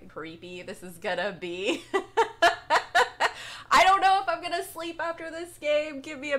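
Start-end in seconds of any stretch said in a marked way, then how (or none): 1.9-3.7 a short, nervous laugh. Real, not acted. Gamer starting a horror game, nervous laugh.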